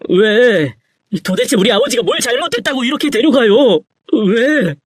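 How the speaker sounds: high, reproachful grief; anguished outcry. A narrator's reading. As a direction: cry out against God for the loss. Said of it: "전 목이 터져라 신을 원망했습니다".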